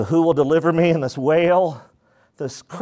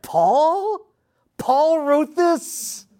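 high, slow astonished question; disbelief; 12 semitones higher, much slower than usual. Retold or imitated. Listener imagining reading Paul's letter, high slow disbelief. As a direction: sound amazed it was Paul. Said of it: "But you're like".

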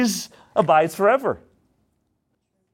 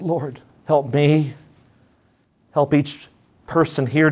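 prayer; 2 semitones lower than usual. Acted. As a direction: plead with the Father for the church. Leads congregation in prayer.

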